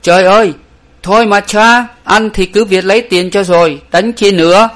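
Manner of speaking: very high, loud pleading wail; crying out pleading. A narrator's reading. Frightened backer begs the fighters to stop, high and loud. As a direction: beg them to stop the fight. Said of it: "la lên rằng".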